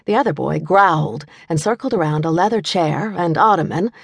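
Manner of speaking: ordinary delivery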